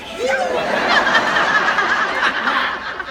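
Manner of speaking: put-on loud, high delighted child's squeal; high squealing kid laugh. Boy squeals with joy inside the Lamborghini, loud and high. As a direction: squeal like a thrilled little boy. Son thrilled in the car.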